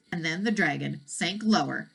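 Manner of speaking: usual speaking voice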